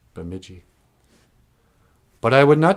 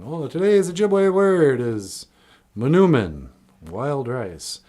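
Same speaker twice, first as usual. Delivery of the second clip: slow puppet-host voice, higher and louder; Nana Buju puppet voice; 4 semitones higher, 4 dB louder, slower than usual. Pretend. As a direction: perform the Nana Buju puppet announcing the word. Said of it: "Nana Buju be talking".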